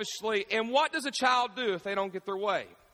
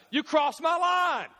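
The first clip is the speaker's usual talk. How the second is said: put-on childish shout; 6 semitones higher, slower than usual. Kids quarrel in car.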